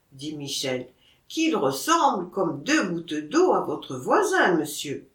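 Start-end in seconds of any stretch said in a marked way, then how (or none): none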